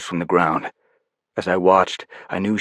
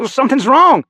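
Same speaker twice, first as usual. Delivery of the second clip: worried; 7 dB louder than usual. Acted. Panic over failing device.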